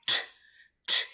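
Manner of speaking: "t" as a crisp burst of air; unvoiced T sound. Shown as a demonstration. Teacher explaining endings after unvoiced sounds, crisp isolated T. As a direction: release "t" with no vowel. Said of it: "this one like".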